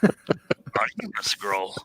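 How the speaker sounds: slow, unhurried whispered sage; whispered Yoda. Pretend. Host improvising Yoda lines for co-hosts, slow whisper. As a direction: draw it out slowly in a whisper. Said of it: "A S M R Yoda, whispering".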